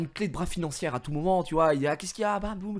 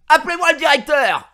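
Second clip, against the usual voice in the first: loud, high hammed-up demand for the boss; demanding shout; 13 dB louder than usual. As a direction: bellow for the director like a furious customer. Storming into the office. (crier pour exiger le directeur)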